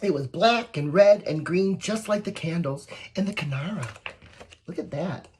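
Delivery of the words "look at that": ordinary delivery, nothing marked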